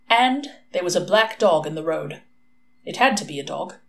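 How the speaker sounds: plain reading voice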